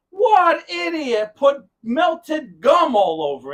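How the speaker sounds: loud, high, drawn-out mock outrage; acted outrage. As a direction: imitate an appalled repairman yelling slowly. Repairman's outrage retold in story.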